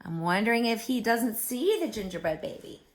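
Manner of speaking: usual speaking voice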